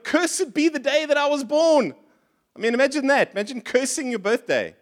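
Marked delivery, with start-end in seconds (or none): 0.0-1.9 high, dramatic lament; a touch of mock despair. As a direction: curse your birth, half-acted. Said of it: "even says at one point".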